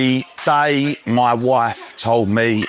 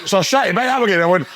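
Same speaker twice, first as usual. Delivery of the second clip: usual speaking voice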